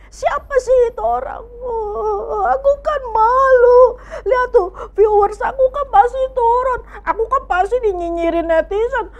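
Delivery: high, loud hammed-up whining sob; overacted crying vlogger; mock crying. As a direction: wail like an embarrassed vlogger. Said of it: "Dia ingin".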